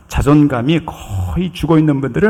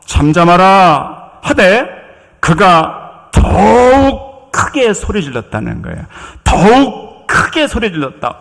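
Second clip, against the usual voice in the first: impassioned preaching; 5 semitones higher than usual. Acted. Preacher reading blind man's story to congregation, impassioned. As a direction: preach it with rising force, stretching the big words.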